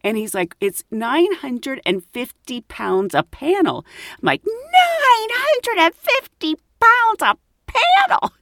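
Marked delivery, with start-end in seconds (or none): 0.6-3.8 slow, higher, measured quote of a price; lightly pompous shopkeeper, spelling it out; highbrow man. Retold or imitated. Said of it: "Let's say that, highbrow man". 4.5-8.3 very high, slow mock squeal; shocked echo. Repeats the price in shock.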